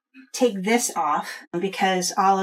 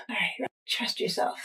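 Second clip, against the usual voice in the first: whispering; 8 dB quieter than usual. Real, not acted. Self-encouragement whisper.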